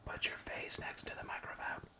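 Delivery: hushed whisper at the mic. Real, not acted. Dad whispering mic advice to his reading daughter. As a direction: whisper the instruction close to her ear.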